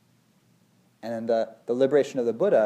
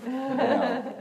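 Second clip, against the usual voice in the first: laughing; 14 semitones higher than usual. Real, not acted. Laughing at his own joke.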